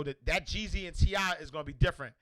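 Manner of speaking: normal talking voice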